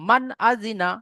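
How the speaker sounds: মান আজিনা with the ন of মান said clearly, no nasal hum; teacher's model: মান আজিনা, nun without ghunna; clear izhar reading. Shown as a demonstration. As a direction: read মান আজিনা crisply, nun plain and short. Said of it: "এইভাবে আমাদেরকে পড়তে হবে".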